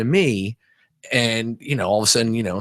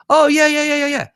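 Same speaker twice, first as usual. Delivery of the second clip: high, hurried mock eagerness; sudden recognition; 4 dB louder than usual. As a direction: imitate a stranger suddenly remembering the visitor. Retold intercom person recognises him.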